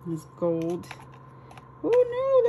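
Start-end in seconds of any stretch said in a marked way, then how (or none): 1.8-2.5 loud, very high squeal; delighted squeal. Real, not acted. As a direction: cry out, overcome by cuteness. Overwhelmed by cute coffee cups.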